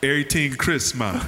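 put-on news anchor in a Jamaican accent, laughing; Walter Cronkite Jamaican accent. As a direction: Jamaican accent newsreader, breaking up. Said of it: "can you do it?".

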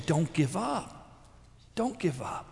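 soft, slow plea; gentle urging. Retold or imitated. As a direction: drop the volume and urge them tenderly. Voicing the woman's plea to listeners.

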